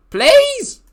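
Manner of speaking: high, squeaky begging please; mock pleading squeak; pleading cry. Real, not acted. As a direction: beg in a silly high voice. Pleading with player.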